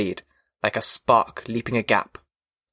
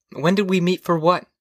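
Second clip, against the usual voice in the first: puzzled wife, pitched higher; higher voice for a questioning wife; 6 semitones higher than usual. A narrator's reading. Wife puzzled by husband's question in the dark, high voice. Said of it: "she asked".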